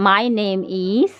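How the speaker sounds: slow model answer. Shown as a demonstration. Teacher modelling the answer slowly for students. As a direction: leave the sentence hanging for the learner. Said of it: "বলতে হবে আমার নামটা".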